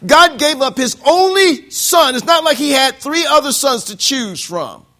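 loud, high gospel shout; full-force preaching. Real, not acted. Preacher proclaiming John three sixteen to the congregation. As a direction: preach it with fire.

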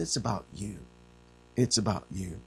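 soft, intimate repetition; Paul telling the church, soft and tender. Acted. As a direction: say it gently to them. Paul's soft plea.

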